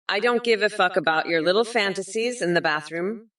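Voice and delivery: ordinary talk, nothing marked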